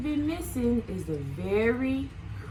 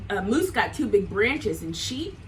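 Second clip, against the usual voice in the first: plain reading voice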